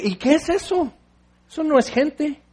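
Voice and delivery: pregunta aguda y espantada; suéltalo como quien no lo cree; escandalizado. Real, not acted. Reacción escandalizada ante el niño. (sorpresa escandalizada, más aguda)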